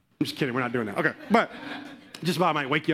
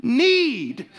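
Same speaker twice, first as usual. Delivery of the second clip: fervent preaching; 8 semitones higher than usual. Real, not acted. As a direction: preach it home to the church. Preaching at full force.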